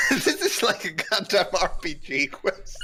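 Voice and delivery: laughing. Real, not acted. Laughing at the quest.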